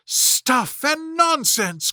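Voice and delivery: a mild protest made big; Alice cries out. A narrator's reading. Alice protesting to the court, mild indignant cry. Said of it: "cried Alice loudly".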